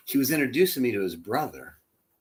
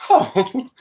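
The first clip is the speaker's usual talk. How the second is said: swooning oh; 12 semitones higher, 8 dB louder than usual. Retold or imitated. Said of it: "just go".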